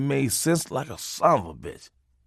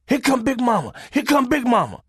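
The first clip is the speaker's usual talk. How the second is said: alarmed warning; 11 semitones higher than usual. Retold or imitated. Warning cry from family.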